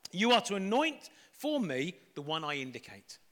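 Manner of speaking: steady, measured Bible reading voice; scripture reading. Acted. Reading Samuel aloud to the congregation, measured scripture voice. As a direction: read the passage aloud, clearly and evenly.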